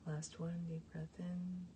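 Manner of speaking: soft, slow meditation voice with long pauses; guided meditation. Real, not acted. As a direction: guide the breathing gently and quietly, drawing out each exhale. Leads cleansing breaths.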